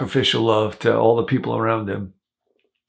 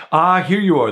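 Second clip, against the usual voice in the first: a bishop's warm greeting, pitched higher and louder; 8 semitones higher, 4 dB louder than usual. Acted. Bishop greets the returned thief, lightly voiced and higher. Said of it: "the bishop says".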